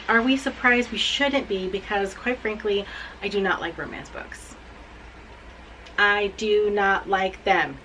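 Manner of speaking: ordinary talk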